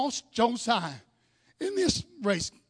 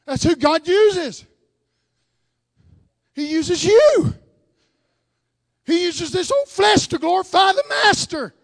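slow, loud, drawn-out proclamation; full-force preaching; 4 semitones higher, 7 dB louder, slower than usual. Acted. Preacher telling the church God uses their flesh, slow and loud. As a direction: lean on every word and build it up.

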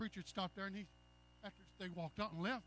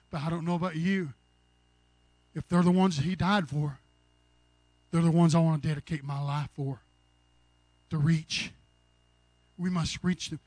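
louder and louder preaching climax; preaching at full force; 3 semitones lower, 14 dB louder than usual. Acted. Preacher rousing his congregation, building to a shout.

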